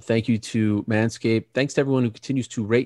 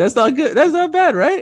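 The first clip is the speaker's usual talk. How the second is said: mock high voice; 5 dB louder than usual. Joking about bakery name.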